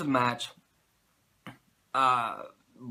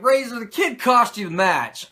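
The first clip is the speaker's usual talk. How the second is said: louder, higher announcer-ish imitation; McMahon interviewing; 11 semitones higher, 9 dB louder than usual. McMahon needles Razor in a loud mock interviewer voice. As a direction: push the accusation like a host. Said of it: "he's basically like".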